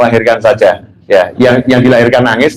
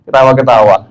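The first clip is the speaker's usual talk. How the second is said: normal talking voice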